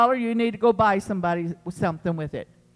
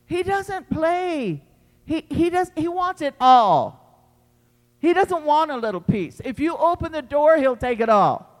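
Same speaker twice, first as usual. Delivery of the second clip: higher, louder preaching build; preaching at full force; 7 semitones higher, 6 dB louder than usual. Acted. Preacher warning the church about Satan, full-force preaching. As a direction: warn them hard, rising to a cry.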